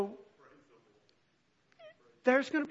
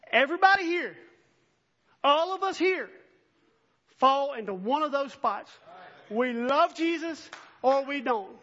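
loud, raised preaching voice; full-force preaching; 4 semitones higher, 7 dB louder than usual. Acted. Preacher challenging his congregation, loud and high. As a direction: hammer it home to the room.

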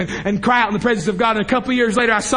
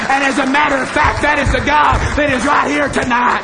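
loud, raised preaching over music; full-force preaching; 3 semitones higher, 3 dB louder than usual. Real, not acted. Preacher proclaims God to his congregation, full-force loud preaching. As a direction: preach it out, ringing and high.

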